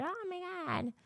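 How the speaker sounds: high, gushing mock-excited voice; mock excitement. Recalling how excited she was about MAHA, high and gushing. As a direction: gush it like a starry-eyed fan, high. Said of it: "I was excited".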